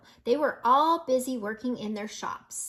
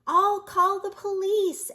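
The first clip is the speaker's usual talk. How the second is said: higher, louder worried turtle; urgent call; 9 semitones higher, 6 dB louder than usual. A narrator's reading. Mister Turtle offering help, loud and urgent. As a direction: sound the alarm. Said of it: "added Mister Turtle".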